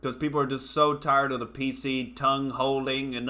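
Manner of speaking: usual speaking voice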